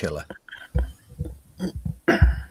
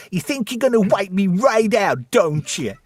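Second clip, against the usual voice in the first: put-on high, thick New Jersey accent voice; 9 semitones higher than usual. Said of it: "a thick New Jersey accent".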